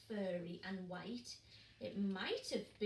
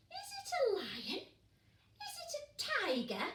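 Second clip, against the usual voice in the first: squeaky chick asking eagerly; high, curious little penguins; high little penguin voices; 12 semitones higher, 4 dB louder than usual. Acted. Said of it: "asked Peaky and Poots".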